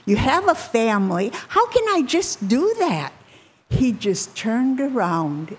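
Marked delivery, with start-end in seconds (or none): 0.1-3.1 high, swinging incredulous protest. Retold or imitated. Rich man balking at giving up his money, incredulous. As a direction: voice disbelief, pitch leaping up at the end. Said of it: "He couldn't believe it".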